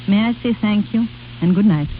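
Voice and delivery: ordinary delivery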